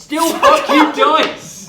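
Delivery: laughing angry shout. Real, not acted. Player cursing the dice despite advantage, exasperated laughing shout. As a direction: yell at the dice, half laughing.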